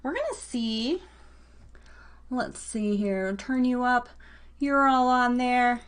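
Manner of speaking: usual speaking voice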